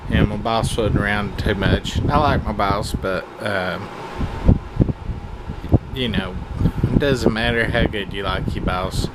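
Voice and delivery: usual speaking voice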